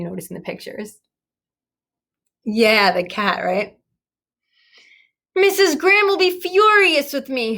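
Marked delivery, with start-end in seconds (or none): 5.3-7.6 moaning. A narrator's reading. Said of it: "Stella moaned".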